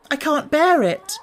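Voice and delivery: high, strained outburst; girl at the end of her nerves; desperate cry. A narrator's reading. Mary alone in her room, cracking under the screams.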